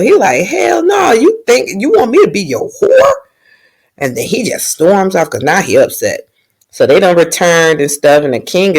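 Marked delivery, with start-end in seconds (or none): 0.4-3.1 high angry yell, retold; angry shout. Knight insulted by princess's offer, retold high angry shout. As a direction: blow up at the insult. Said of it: "he get mad as hell".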